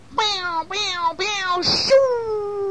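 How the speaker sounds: high-pitched popping sound effects ending in a long whoosh; storybook whooshing noise; imitated bursting-bloom noises. A narrator's reading. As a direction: squeal the buds springing open. Imitated noise of buds bursting open.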